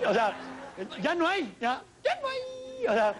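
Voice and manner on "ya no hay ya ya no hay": high thin falsetto, slowed; mock falsetto whine; squeaky high voice. Mock falsetto bit on stage.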